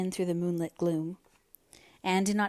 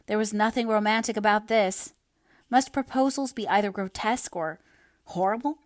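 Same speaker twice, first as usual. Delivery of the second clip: ordinary delivery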